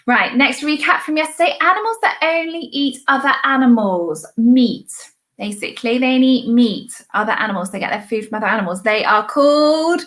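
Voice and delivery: ordinary talk, nothing marked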